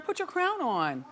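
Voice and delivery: ordinary delivery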